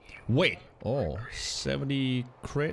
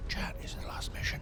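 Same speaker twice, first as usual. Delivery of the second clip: whispered aside to chat; 8 dB quieter than usual. Real, not acted. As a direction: whisper the question.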